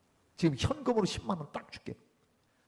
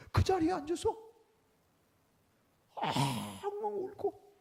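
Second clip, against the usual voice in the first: tearful retelling; 8 semitones higher than usual. A narrator's reading. Preacher recounting the friend breaking down in tears, choked up. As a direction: voice breaking with emotion.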